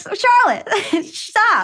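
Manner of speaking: high laughing protest; laughing plea. Retold or imitated. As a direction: plead with her through laughter. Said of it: "I was just like".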